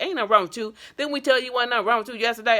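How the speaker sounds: imitating the receptionist's loud, quick scolding; dismissive receptionist. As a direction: rattle it off like she's fed up with you. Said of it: "She was like".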